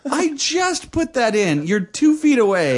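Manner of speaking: high, drawn-out incredulous protest; exasperated. Retold or imitated. Boyfriend explaining the hook to girlfriend, exasperated. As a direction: retell it in hammed-up exasperation.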